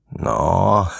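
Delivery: drawn-out "nå" with a chuckle; grinning. A narrator's reading. Ivan hears the boys back down, laughing. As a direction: chuckle smugly after the word. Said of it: "sagde Ivan og grinede".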